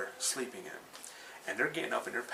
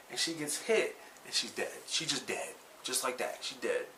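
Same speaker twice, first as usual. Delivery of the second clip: ordinary delivery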